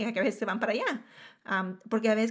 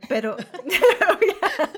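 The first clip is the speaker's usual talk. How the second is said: high, loud laugh through words; 10 semitones higher, 12 dB louder, much slower than usual. Real, not acted. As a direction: laugh it off, much higher. Laughing at own slip.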